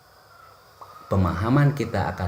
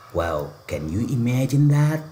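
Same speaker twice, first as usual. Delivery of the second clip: mock amazement; 6 semitones higher, slower than usual. Mock horror at eaten banana.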